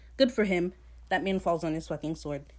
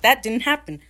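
mocking outburst; 5 semitones higher, 9 dB louder than usual. Mocking people who deny history.

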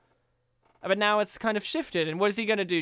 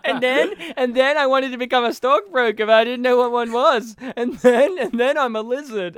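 put-on loud, slightly higher excitable child; Ralph Wiggum; 3 semitones higher, 6 dB louder than usual. Host mocks actor's trivia as a child's, excitable kid voice. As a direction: gush like a little kid telling facts. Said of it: "a child or something".

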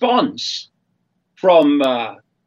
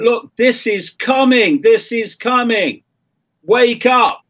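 raised, insistent warning; urgent warning; 6 semitones higher than usual. Retold or imitated. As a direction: call it out urgently, higher pitch. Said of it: "all these years of saying".

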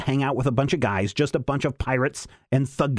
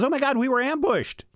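high frantic alarm; panicked cry; 13 semitones higher than usual. Retold or imitated. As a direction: squeal it out in panic. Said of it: "Lightning Lass beams right back and says".

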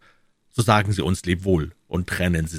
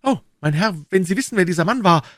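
put-on high, loud, rushed young D'Artagnan; crying out urgently; 13 semitones higher, 6 dB louder, faster than usual. A narrator's reading. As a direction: burst out like an impatient youth. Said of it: "rief D'Artagnan". (aufgeregt und hoch ausgerufen)

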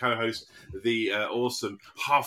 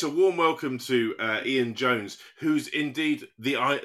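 ordinary talk, nothing marked

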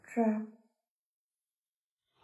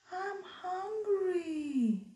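small goat voice; 8 semitones higher, slower than usual. Acted. Goat speaks.

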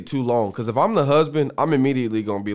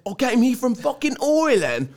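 high-pitched muddled Australian-Irish accent; bad Australian accent; 11 semitones higher than usual. Shown as a demonstration. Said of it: "can't do my Australian accent".

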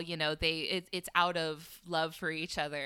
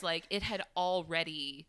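ordinary talk

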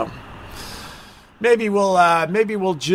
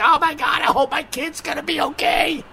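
mock worried parent; 9 semitones higher than usual. Parents at home fretting over their kid, hammed-up panic. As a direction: ham up a frantic parent. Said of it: "They're crying like".

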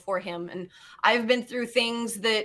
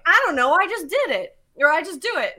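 lightly imitated helpless answer, higher pitched; clueless protest; 5 semitones higher, 5 dB louder than usual. Fiance pressed to explain his trick, mock helpless.

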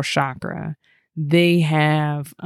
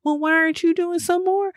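much higher, coaxing and pushy; teasing high voice; 12 semitones higher than usual. Retold or imitated. Urging her boo to use his energy, high coaxing voice. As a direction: squeak it out like nudging a lazy partner into chores. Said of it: "I was like".

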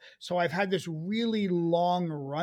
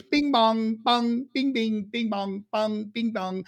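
sing-song bing-bong impression; higher, chiming imitation of a politician; Trump; 3 semitones higher, slower than usual. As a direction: bounce the nonsense words like the president. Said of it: "during the campaign, he goes".

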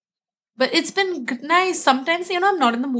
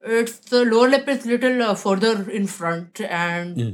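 Uttar Pradesh accented English; central Indian accent. Shown as a demonstration. Actress giving an accent class on request, Uttar Pradesh accent. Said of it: "It's accent from middle central India".

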